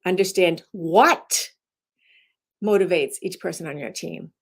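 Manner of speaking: "what" stressed and rising, then a beat's pause. Shown as a demonstration. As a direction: hang on "what" as the key word. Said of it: "you emphasize it to change the meaning".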